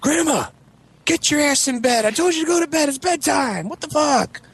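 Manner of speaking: very high mock scolding shout; acting out a fed-up grandson yelling; exasperated yelling. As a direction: holler across the house in a squawking falsetto. Friend scolds his wandering grandma.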